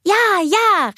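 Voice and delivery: put-on high, loud, thrilled child; excited cry. A narrator's reading. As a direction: shout yes with delight. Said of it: "rief Anna". (hoher, begeisterter Ausruf)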